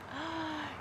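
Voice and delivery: sharp surprised gasp; a quick caught-breath gasp; retold gasp. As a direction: gasp in amazement. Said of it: "I was like".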